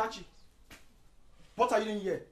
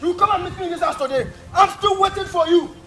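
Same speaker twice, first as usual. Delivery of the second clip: loud, higher furious dare; defiant challenge; 5 semitones higher, 11 dB louder than usual. Acted. Son daring his father to come meet him, loud and defiant. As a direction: call him out to come face you.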